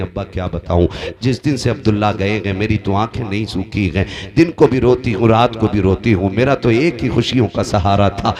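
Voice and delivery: loud plaintive preaching, rising from low; acted tearful lament. Widowed Amina pleads to her father-in-law, acted tearful lament. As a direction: lament loudly as the weeping widow. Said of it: "रो के कहती हैं".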